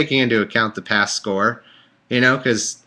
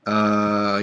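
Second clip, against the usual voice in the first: ordinary talk, nothing marked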